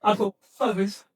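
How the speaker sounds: ordinary talk, nothing marked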